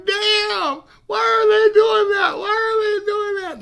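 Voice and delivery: high, loud anguished shout; upset child shouting. Retold or imitated. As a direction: yell it as a hurt little boy watching. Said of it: "I'm going".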